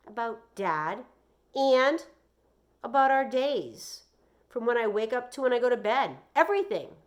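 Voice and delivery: put-on high, loud boy listing it all; upset boy. A narrator's reading. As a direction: push every word out. Joey answering mom.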